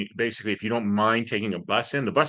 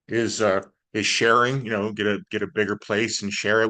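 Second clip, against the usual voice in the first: usual speaking voice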